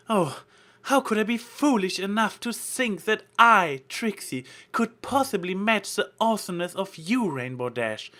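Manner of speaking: mock-sincere theatrical awe; theatrical flattery. A narrator's reading. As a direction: ham up the humble praise. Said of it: "same theatrical but convincing tone".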